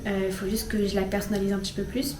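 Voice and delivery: ordinary talk, nothing marked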